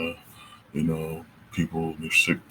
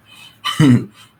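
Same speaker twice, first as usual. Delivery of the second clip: short, high, loud chuckle; brief real laugh at yourself; amused laugh; 7 semitones higher, 14 dB louder than usual. Real, not acted. Speaker laughing at own monologue wording, amused chuckle.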